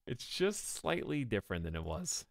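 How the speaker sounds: ordinary talk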